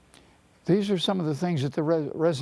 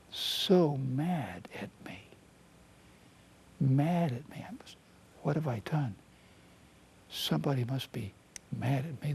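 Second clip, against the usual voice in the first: anxious inner critic; 5 dB quieter than usual. Acted. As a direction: breathe it out small and scared. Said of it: "This is how our resident critic sounds".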